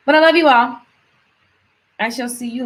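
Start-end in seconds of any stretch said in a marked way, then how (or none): 0.1-0.8 loud sign-off. Real, not acted. Sign-off to viewers.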